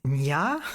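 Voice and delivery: "nya" as NYAH, rising; Japanese pronunciation. Shown as a demonstration. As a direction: draw out "nya" with a questioning lift. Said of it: "in Japanese".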